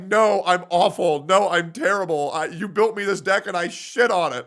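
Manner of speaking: high, loud imitation of a remorseful girlfriend; mock remorse. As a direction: play her sorry and distraught. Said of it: "she's like".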